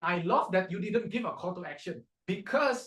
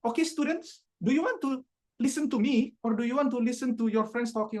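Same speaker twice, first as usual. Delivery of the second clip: lightly voiced teacher, higher pitch; a hint of a teacher challenging chatty students; teacher addressing class; 5 semitones higher than usual. Teacher to students talking over the lesson, mildly challenging. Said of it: "when your teachers will suddenly say".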